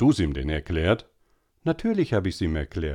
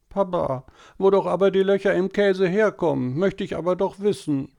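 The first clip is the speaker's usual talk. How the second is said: put-on high, whining little boy voice; whiny boy; 11 semitones higher, 3 dB louder than usual. A narrator's reading. Tobi whining to mama at dinner, high and whiny. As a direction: whine like a small boy pestering mama. Tobi asks papa again.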